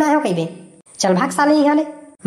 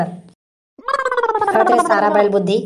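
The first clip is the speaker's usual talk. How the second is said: high, slow, stretched-out groan of disbelief; exasperated drawn-out scolding; 8 semitones higher, much slower than usual. Acted. Candidate scolds his bodyguard for giving the bullets away, high and slow in disbelief. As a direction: drag it out as if you can't believe how dumb he is.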